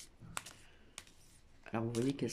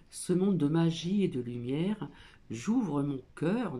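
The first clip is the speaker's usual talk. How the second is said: card reading; 2 semitones higher, 7 dB louder than usual. Acted. Reads the card text aloud.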